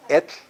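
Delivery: Et ending in a hissed voiceless tl; lateral TL release. Shown as a demonstration. Teacher giving word for bean, lateral TL release. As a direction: release the end of Et at the tongue sides. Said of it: "Release the air at the sides".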